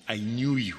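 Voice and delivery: "I knew you" said low and soft, falling; gentle God voice. Acted. God reassuring a scared Jeremiah, soft and low. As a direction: murmur "I knew you" softly, low and tender. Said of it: "God says to Jeremiah".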